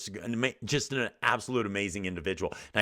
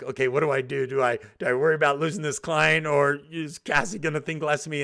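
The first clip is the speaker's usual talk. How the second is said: higher, fast, panicky self-questioning; anxious inner voice; 5 semitones higher, 3 dB louder, faster than usual. Retold or imitated. New consultant doubting his price, rushed higher anxious voice. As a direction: fret aloud as if you might lose the client.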